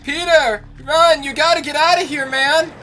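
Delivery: very high, loud put-on scream; hammed-up panicked yell. Player warning Peter to get out, very high mock scream. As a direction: shriek a mock warning at the top of the range.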